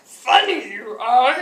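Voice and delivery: mock Yoda compliment. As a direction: drag it out like Yoda. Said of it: "he would not stop talking like Yoda".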